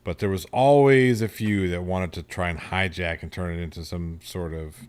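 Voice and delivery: normal talking voice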